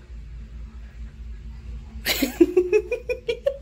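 airy high-pitched giggle, can't hold it in; real helpless giggle; high breathless laugh. Real, not acted. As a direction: burst out in a wheezy laugh at the dog. Laughing at dog staring.

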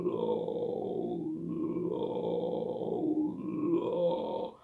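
creaky fry on low, soft and slow; vocal fry low. Shown as a demonstration. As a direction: croak each low down at the bottom. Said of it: "Então, faça low". (low em vocal fry, grave e rangido)

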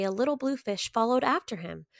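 plain reading voice

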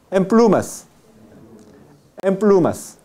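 "en plumas" said as "em plumas", n turned to m before the p; model pronunciation. Shown as a demonstration. Teacher modelling "en plumas" for students, n blended into m. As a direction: carefully model "en plumas" as one word "emplumas". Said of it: "Son sonidos idénticos".

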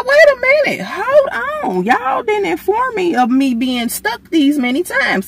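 high-pitched, loud, alarmed outcry; alarmed protest. Retold or imitated. Patient protesting to the doctor about extra needles, shrill alarmed yell. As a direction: shriek it like you just heard bad news.

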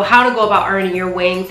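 ordinary talk, nothing marked

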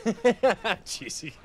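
laughs, high and breathless; laughing. Real, not acted. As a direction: break up laughing. Laughing, heard.

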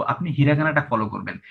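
ordinary talk

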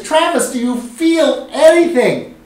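high, loud, slow call to Travis; pleading question from the floor; urgent asking. Retold or imitated. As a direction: press him hard on whether he feels it. Urgently asking the unaffected student.

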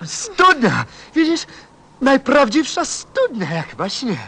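overjoyed cry at finding the well; excited discovery. Acted. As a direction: cry out in amazed joy. Showing the well to friend.

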